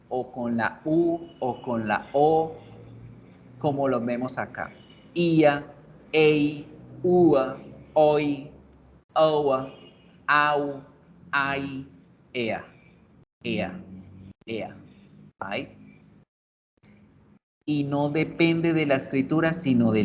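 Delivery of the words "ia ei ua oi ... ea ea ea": each of "Ia, ei, ua" one drawn glide, then a pause; isolated diphthongs. Shown as a demonstration. Teacher listing for learners, diphthongs like "oi", "au" said singly. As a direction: recite the vowel pairs singly, first sound gliding into second. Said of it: "estos diptongos nacen de la combinación".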